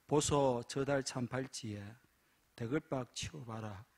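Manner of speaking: lightly played Gyeongsang dialect, soft gruff reply; a hint of Gyeongsang dialect. Preacher voicing a Gyeongsang husband, in Gyeongsang dialect. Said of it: "경상도 여자".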